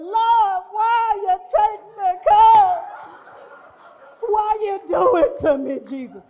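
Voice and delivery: high, slow hammed-up wail to the Lord; mock wailing mourner. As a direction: ham up a grieving mourner's cry. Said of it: "I need you to just be weeping and wailing".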